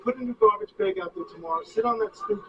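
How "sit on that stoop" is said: normal talking voice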